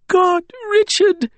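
very high, slow seething lament. A narrator's reading. Wren wipes angrily at her eyes, seething. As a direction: drag the words out in tearful anger. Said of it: "but seething now".

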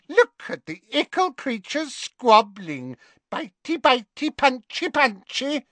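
high, sing-song mocking spirit voice; gleeful poltergeist. Peeves jeering at the elves, high and gleeful. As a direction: taunt the squabbling elves with glee.

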